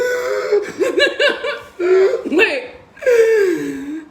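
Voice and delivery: high, loud helpless laughter; real laugh that nearly turns to tears; laughing fit. Real, not acted. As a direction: crack up at your own story. Cracks up at own story.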